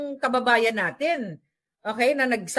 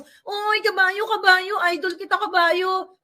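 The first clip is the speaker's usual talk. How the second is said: mock fan greeting; 7 semitones higher, slower than usual. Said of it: "Sasabihin ko".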